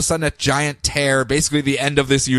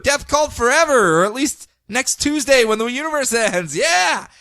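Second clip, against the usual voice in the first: mock cheering cultist; 8 semitones higher than usual. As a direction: cheer like a gleeful doomsday fan. Mock cheering death cult.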